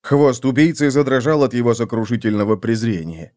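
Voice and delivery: usual speaking voice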